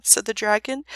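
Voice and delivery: ordinary delivery, nothing marked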